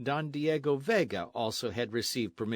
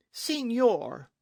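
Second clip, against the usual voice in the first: high, loud cry of protest; affronted exclamation; 11 semitones higher, 5 dB louder than usual. A narrator's reading. Señorita objects to the captain's insult, affronted. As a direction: exclaim in offended shock.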